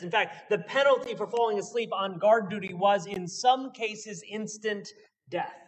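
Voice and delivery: ordinary talk, nothing marked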